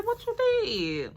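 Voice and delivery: sharp high disbelieving question; shocked question. Retold or imitated. To the guy who broke the car, high shocked demand. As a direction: demand to know, way up in pitch. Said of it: "I said".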